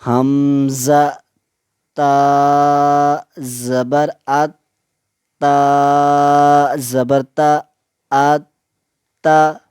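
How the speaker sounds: each letter drawn out and even; slow qaida spelling. Shown as a demonstration. Teacher spells slowly, pausing for students to repeat. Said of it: "जैसे कि".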